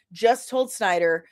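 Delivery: ordinary talk, nothing marked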